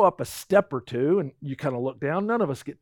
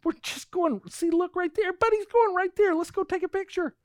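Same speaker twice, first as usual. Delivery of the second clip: much higher, eager and coaxing; a kid begging to go see something; excited; 13 semitones higher than usual. Retold or imitated. Husband urging wife toward the viewpoint, high and excited. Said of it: "I get all excited".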